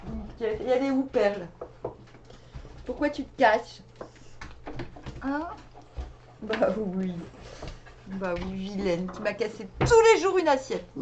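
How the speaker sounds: loud, teasing scolding of the pets; playful scolding. Real, not acted. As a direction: tell off the dog fondly.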